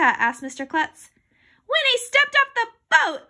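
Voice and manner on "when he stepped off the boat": high, loud eager answer; excited shout. A narrator's reading. Boy racing to answer a quiz, excited shout. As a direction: yell it out in a burst. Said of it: "I shouted".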